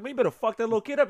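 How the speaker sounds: high, excited retelling of the homie's dare; retold egging on. Telling the story of the tour driver, high and excited. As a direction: voice the friend hyping him up. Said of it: "he said".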